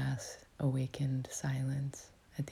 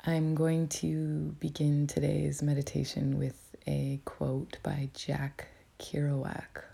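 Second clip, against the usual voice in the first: whispering. Real, not acted. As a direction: whisper it gently, like guiding a meditation. Whispers throughout to guide meditation.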